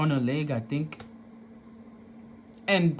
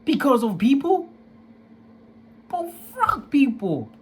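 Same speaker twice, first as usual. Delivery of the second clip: higher, louder incredulous anger; mad; 10 semitones higher, 5 dB louder than usual. Retold or imitated. Son angry that mother fears gossip, higher and louder. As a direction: spit it out in furious disbelief. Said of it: "I was so mad that day".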